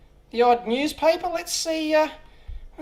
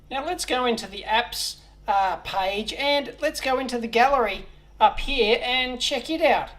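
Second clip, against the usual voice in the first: ordinary delivery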